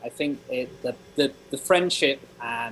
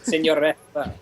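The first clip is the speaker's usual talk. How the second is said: put-on Serbian accent; 2 semitones higher, 3 dB louder than usual. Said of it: "put on your Serbian accent".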